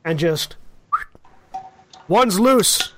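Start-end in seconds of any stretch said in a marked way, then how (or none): 2.1-2.9 alarmed call. Acted. Guard notices missing prisoner.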